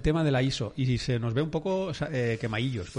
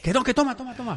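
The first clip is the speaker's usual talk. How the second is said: higher, pushy mum's voice; mother forcing her ramen on her son, higher; mother insisting; 9 semitones higher, faster than usual. Retold or imitated. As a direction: keep shoving the bowl at him. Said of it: "mi madre dice".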